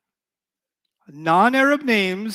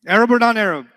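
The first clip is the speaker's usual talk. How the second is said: much louder, punchy quiz; emphatic question; 5 semitones higher, 9 dB louder, faster than usual. Shown as a demonstration. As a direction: fire the question at the class. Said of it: "quizzing class".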